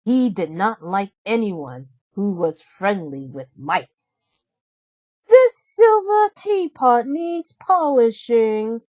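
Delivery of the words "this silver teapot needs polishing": higher, louder, slow snooty order; haughty stepsister. A narrator's reading. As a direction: drawl it out haughtily. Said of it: "Drizella said".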